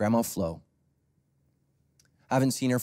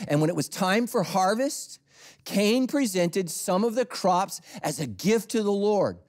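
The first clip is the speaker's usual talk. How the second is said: high, loud scripture preaching; fervent pulpit reading; preaching at full force; 9 semitones higher, 5 dB louder, slower than usual. Acted. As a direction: proclaim it to the back row.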